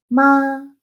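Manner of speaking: MA held high, level and loud; first tone. Shown as a demonstration. Teacher running through the tones, high sustained "ma". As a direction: sustain "ma" on a straight high note. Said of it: "tendríamos que el primer tono".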